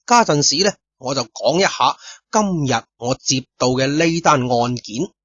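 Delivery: usual speaking voice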